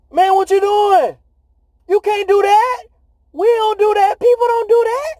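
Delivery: high, incredulous mock voice of the disciples; hammed-up high disbelieving disciples; disciples in disbelief. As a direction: squeak out the disbelief like a stunned friend. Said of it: "And they said".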